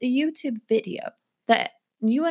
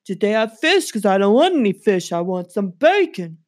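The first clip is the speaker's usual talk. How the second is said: louder, pushy mafia dog; gangster dog voice; 5 dB louder than usual. Pretend. Poodle Megan demands turkey and bacon from Grace, in a loud pushy voice. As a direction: make demands like a mob boss poodle.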